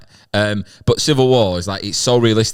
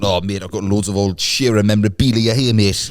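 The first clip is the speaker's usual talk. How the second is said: lightly voiced Geordie stallholder; chirpy Geordie seller offering old Shearer memorabilia; a hint of Newcastle market patter; Geordie trader. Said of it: "all these people pulling this out".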